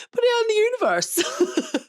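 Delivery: high, airy mock manifesting chant. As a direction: sing it out like a dreamy affirmation. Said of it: "This is me, like, doing".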